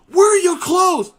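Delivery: loud, high, slow demand; officer's shout. Retold or imitated. Officer demanding Murphy's clothes, loud high slow shout. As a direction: bellow at the naked sailor. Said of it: "he said".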